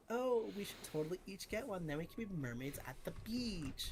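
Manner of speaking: mock ditzy girl voice, swooping high; dumb girl act. Reality show girls performing for the camera, acting dumb. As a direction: play up the airhead act with a high, sliding pitch. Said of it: "had these girls acting really dumb".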